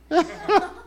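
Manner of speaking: shrill jeering cackle; mocking accuser's laugh; high mocking laugh. Accuser mocks drummer.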